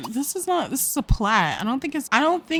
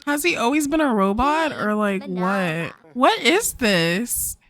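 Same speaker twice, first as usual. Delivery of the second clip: ordinary talk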